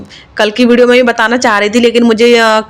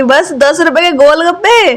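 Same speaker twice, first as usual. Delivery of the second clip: put-on child's voice; 7 semitones higher, slower than usual. Child's indignant reply voiced by mother.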